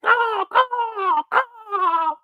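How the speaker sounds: high squawking caw; bird caw. Retold or imitated. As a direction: squawk like a hurt oily bird. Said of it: "That bird is like".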